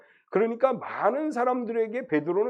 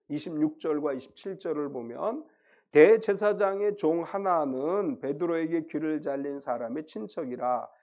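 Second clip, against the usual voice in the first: ordinary delivery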